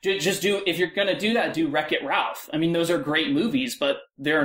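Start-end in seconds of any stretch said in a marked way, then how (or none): none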